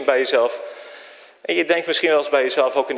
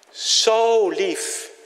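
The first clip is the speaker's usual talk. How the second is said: high, stretched zo lief; awed tenderness; tender; 8 semitones higher than usual. Acted. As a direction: linger on the love.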